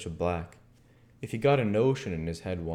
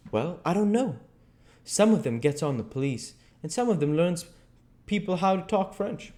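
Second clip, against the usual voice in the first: put-on higher, lively boyish storybook voice; Huck, explaining; 9 semitones higher than usual. Huck tells Jim what exiled kings do, explaining. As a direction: explain it readily, pitched above narration.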